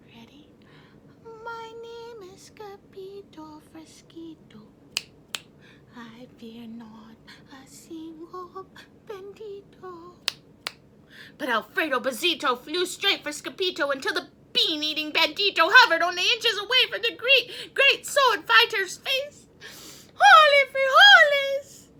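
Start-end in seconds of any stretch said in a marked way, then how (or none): 0.1-10.2 softer and softer, slow, to a whisper; fading whisper. Acted. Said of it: "in a more and more soft voice". 20.1-21.9 very high, loud, slow cry; battle cry. Acted. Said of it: "cried Scapito".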